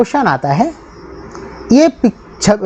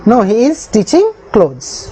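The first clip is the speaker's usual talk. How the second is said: the whole answer drawn out very slowly; slow dictation; much slower than usual. Shown as a demonstration. Teacher rereads the whole answer very slowly for the class. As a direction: read it slowly in one go. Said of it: "teacher dictating English answer".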